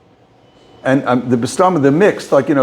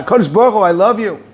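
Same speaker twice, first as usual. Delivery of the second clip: retold outcry; 6 semitones higher, faster than usual. Repeats the mother's outcry.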